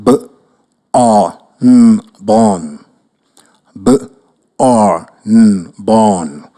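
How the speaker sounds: letters "B-O-R-N" drawn out low, then "born"; spelling it out. Shown as a demonstration.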